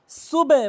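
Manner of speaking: সুবে with the b released into a vowel; correct সুবে. Shown as a demonstration. Teacher correcting learners, open drawn-out সুবে. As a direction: stretch and stress সুবে, opening the b. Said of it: "বলতে হবে".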